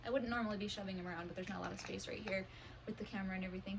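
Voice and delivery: ordinary talk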